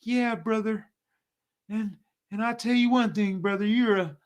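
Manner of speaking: high, sweet-talking brother; smooth talker. Retold or imitated. Mocking the smooth talker the Lord dislikes, high and sweet. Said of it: "the Lord don't want no smooth talking".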